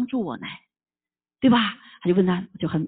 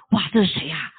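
ordinary talk

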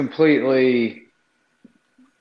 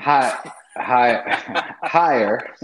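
laughing; 4 dB louder, much slower than usual. Real, not acted. Laughing jab at academic standards.

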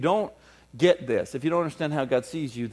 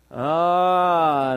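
usual speaking voice

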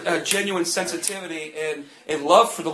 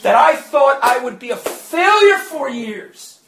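loud, rising preacher's climax, often shouted; preaching at full force; 6 semitones higher, 6 dB louder than usual. Acted. Preacher testifying to congregation about deliverance, loud and fervent. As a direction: drive the testimony home at full volume.

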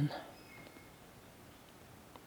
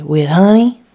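loud, eager rising question; little girl asking; 3 semitones higher, 11 dB louder than usual. Acted. Little Lucy asking mother about her biscuit, eager. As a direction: pipe up hopefully like a small child.

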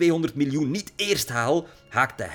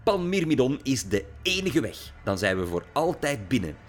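furious rant; 7 semitones higher, 4 dB louder than usual. A narrator's reading. Lily rants at her father.

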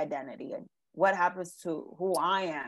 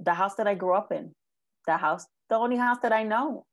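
ordinary delivery

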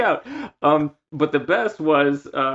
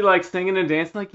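normal talking voice

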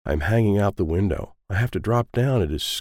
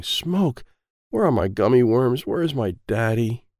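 ordinary delivery, nothing marked

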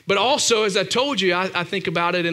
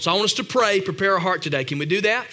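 usual speaking voice

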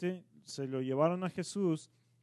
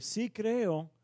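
crying out; 8 semitones higher than usual. Retold or imitated. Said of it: "dice el, el padre".

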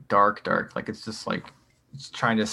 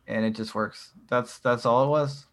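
ordinary talk, nothing marked

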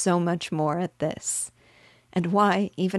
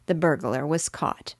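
ordinary delivery

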